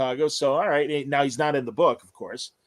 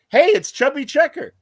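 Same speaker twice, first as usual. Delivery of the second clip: excited recognition; 12 semitones higher, 8 dB louder than usual. Retold or imitated. All three recognize him together.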